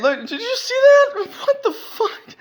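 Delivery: high, laughing shock; cracking up in disbelief. Real, not acted. Viewer laughing at the video, high squeal.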